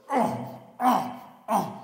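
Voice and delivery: short, loud effort grunts; straining grunts, acted out; paddling grunts. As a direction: grunt with each hard paddle stroke. Paddling hard upriver in kayak.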